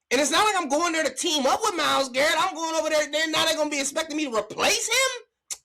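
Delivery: high, loud pretend plea to be left alone; hammed-up player asking for a moment; mock overwhelmed player. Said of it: "That's how I would feel".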